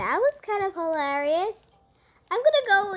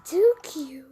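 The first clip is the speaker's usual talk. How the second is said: whispery gush; 4 semitones lower than usual. Pretend. Winding down after squeals.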